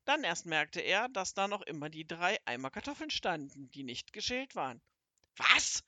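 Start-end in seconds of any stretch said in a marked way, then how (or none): 5.4-5.8 loud, high shocked cry; outraged shout. Acted. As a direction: cry out in disbelief. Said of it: "rief er".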